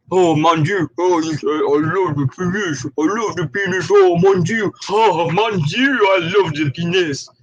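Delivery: overdone French swooning; mock French accent. Mocking lefty fighters, in a hammed-up French accent. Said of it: "It just sounds good in French".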